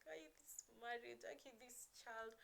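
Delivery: high, soft retold complaint; acting out being at wit's end; overwhelmed venting. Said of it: "when I was having those moments".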